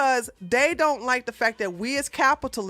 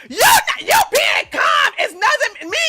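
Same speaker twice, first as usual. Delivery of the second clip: shrill high mock shout, much louder; mock screaming woman; 13 semitones higher, 8 dB louder, faster than usual. Mocking a woman yelling at her calm opponent, screaming. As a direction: screech it like an overwrought arguer. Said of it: "And she's like".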